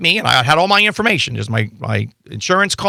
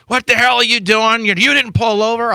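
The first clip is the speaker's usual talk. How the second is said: higher mock yell of an angry trooper; retold trooper yelling; 8 semitones higher than usual. Trooper confronting the lawyer on the roadside, mock angry yell. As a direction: bark it like the irritated trooper, hammed-up. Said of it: "screaming and hollering".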